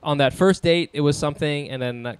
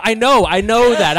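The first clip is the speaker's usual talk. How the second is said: ordinary talk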